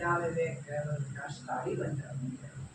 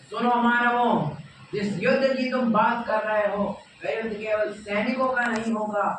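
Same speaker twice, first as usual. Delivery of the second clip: loud, raised oratorical call; commanding address; 4 semitones higher, 7 dB louder than usual. Acted. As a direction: call out to all humankind. Krishna calls out to humankind. (ऊँची, ज़ोरदार ललकार)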